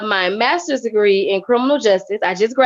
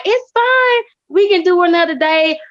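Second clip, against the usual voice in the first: light reassuring quote; 8 semitones higher than usual. Retold or imitated. Quoting host's reassurance.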